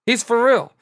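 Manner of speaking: high-pitched amazed realisation; awed alarm. Retold or imitated. Onlooker sizing up a lifer, awed alarm. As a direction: pitch it up, genuinely impressed.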